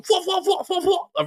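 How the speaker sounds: very high repeated squawk; pitched-way-up staccato catchphrase; imitated vine stutter. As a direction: mimic the meme's squeaky stammer. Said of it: "Caris will just randomly go".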